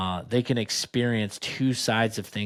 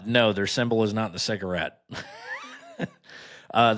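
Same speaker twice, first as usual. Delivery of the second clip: ordinary talk, nothing marked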